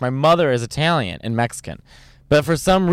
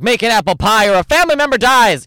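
louder, higher mock-menacing demand; cooking show threat; 7 semitones higher, 5 dB louder than usual. Parodying Chopped challenges, loud mock threat. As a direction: bark an absurd ultimatum, hammed up. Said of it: "And then they're like".

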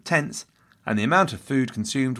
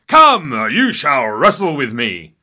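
slow, much louder royal command; kingly challenge; 3 semitones higher, 9 dB louder, slower than usual. Retold or imitated. Henry grabbing Francis by the collar, loud slow challenge. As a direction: boom it out like a king itching for a fight.

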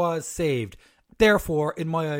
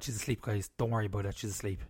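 hushed; 5 semitones lower, 7 dB quieter, faster than usual. Real, not acted. Child waking nearby.

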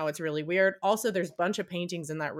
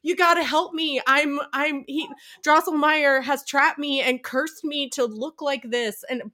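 much higher, urgent plea; high pleading toy; 5 semitones higher, 5 dB louder than usual. Retold or imitated. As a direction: beg like a tiny cursed prince. Nutcracker pleading.

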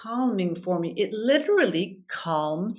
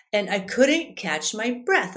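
ordinary talk, nothing marked

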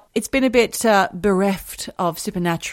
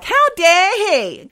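slow, high, loud outrage; mock affront, drawn out; indignant; 10 semitones higher, 8 dB louder, much slower than usual. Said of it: "And I was like".

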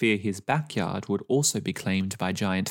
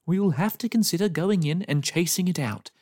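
raised, firm neighbour's declaration; declaring; 7 semitones higher than usual. A narrator's reading. Neighbour declaring to the lane, lightly voiced firm tone. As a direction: announce the plan with resolve. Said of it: "declared Mister Tash".

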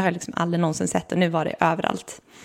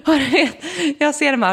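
laughing; 8 semitones higher than usual. Real, not acted. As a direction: laugh at the crazy coincidence. Laughing at the coincidence.

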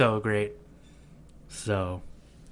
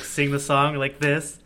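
usual speaking voice

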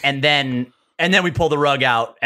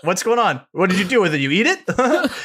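higher, laughing fake innocence; mock innocent; 8 semitones higher than usual. Teller plays innocent to his brother, higher, half laughing. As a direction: play dumb, barely holding back laughter.

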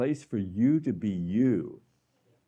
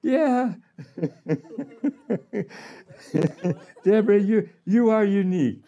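high, louder, beaming voice of God; God speaking fondly; 10 semitones higher, 3 dB louder than usual. Retold or imitated. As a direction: voice God delighting in Deborah with a laugh. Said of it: "He goes".

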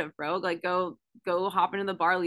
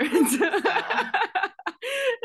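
high squealing laugh; 13 semitones higher, 4 dB louder than usual. Real, not acted. Laughing at own fake title.

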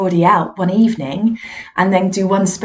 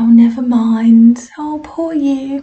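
slow, higher mock sympathy; mock pity; 5 semitones higher, 5 dB louder, slower than usual. Departing audience pitying the medium, slow mock sympathy. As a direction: coo it with fake pity, slowly. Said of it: "people went".

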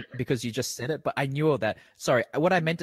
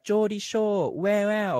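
high-pitched, louder Geordie imitation; Geordie show catchphrase; 9 semitones higher, 5 dB louder, much slower than usual. Host mimics the show's opening title, Geordie imitation. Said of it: "the thing they say".

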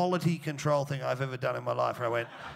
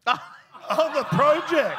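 laughing; 8 semitones higher, 4 dB louder, much slower than usual. Real, not acted.

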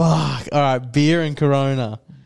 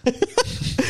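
laughing; 11 semitones higher, 4 dB quieter than usual. Real, not acted. Real laugh at shared joke.

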